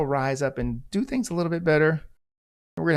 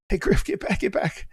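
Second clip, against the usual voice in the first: urgent warning; 7 semitones higher than usual. Retold or imitated. Partner urging him back, retold.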